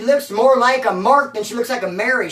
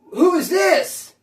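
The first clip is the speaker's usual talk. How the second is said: high, drawn-out mock challenge; imitating alarmed demand; 9 semitones higher, 4 dB louder, much slower than usual. Motel crew confronting her over the stranger, imitated alarmed demand. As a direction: demand it slowly, pitched well up.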